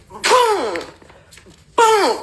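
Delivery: high, punched "boom" for each blow; spoken impact sounds, sharply falling; imitated kick thuds. Imitates two kicks landing.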